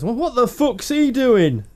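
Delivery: slow, high, drawn-out mock outburst; passerby's outburst. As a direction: play the startled passerby. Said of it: "a guy went past".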